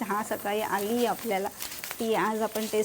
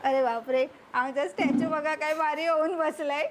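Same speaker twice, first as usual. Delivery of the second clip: ordinary talk, nothing marked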